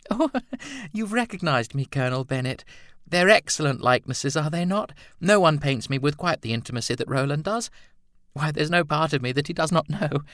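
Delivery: high, teasing artist's model; light laugh, then lilt high and playfully; Edna's voice. A narrator's reading. Edna teasing the colonel about her nude portraits, high and playful.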